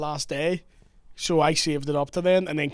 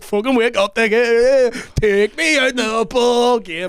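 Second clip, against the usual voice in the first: put-on loud, higher, drawn-out drunken yell; rowdy rousing call; 5 semitones higher, 6 dB louder, slower than usual. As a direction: holler to rouse hungover mates, stretching the last words. Acting out rousing tired friends.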